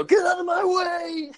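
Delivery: very high, drawn-out mock shriek; mock scream. Joining the bit, screaming at the monkey, mock scream. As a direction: play a comic screamed order. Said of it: "hear me scream from the bedroom".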